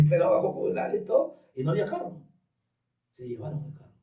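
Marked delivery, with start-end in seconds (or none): none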